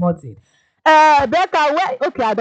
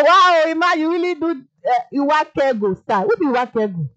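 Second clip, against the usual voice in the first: normal talking voice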